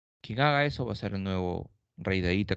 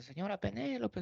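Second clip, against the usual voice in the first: a maid calling out, much higher; excited maids; 10 semitones higher, 9 dB quieter than usual. Retold or imitated. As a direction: call your mistress in a rush, pitched high. Maids announce Odysseus returned.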